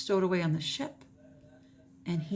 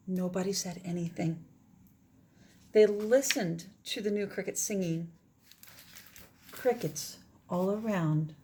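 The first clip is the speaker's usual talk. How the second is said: gentle expressive read-aloud; story narration; 3 semitones higher than usual. Acted. Teacher reading the ending of the story to her class.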